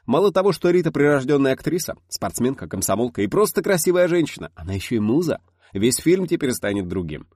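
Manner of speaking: put-on an old film director, mildly gushing; a lightly voiced eager director; lively director. A narrator's reading.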